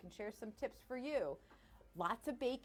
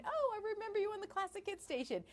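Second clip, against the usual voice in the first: imitating listeners; 11 semitones higher than usual. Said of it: "I know a lot of you have been like".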